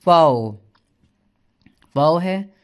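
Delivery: फाओ said loud and clear as the German V; German letter name. Shown as a demonstration. Teacher loudly naming the German V for the class. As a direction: stress the name फाओ firmly. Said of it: "German में होगा".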